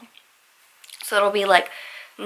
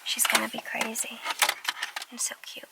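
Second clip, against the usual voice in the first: whispering; 11 dB quieter than usual. Real, not acted. Quietly about to meet puppy.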